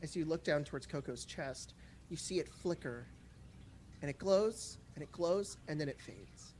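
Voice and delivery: game master narration. A narrator's reading. GM narrates the amulet glowing.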